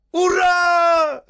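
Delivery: very high, drawn-out shriek; ecstatic, tearful hooray; jubilant yell. Acted. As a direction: scream a cheer with all your might. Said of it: "завопил он".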